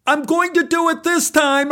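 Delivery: high-pitched shout; high, determined call across the pool. Acted. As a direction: announce it at the top of a high voice. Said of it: "I shouted".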